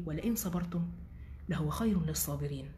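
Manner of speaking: softly whispered Quranic recitation. Shown as a demonstration. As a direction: breathe the verse in a whisper. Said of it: "الآيات دي بحبها أوي".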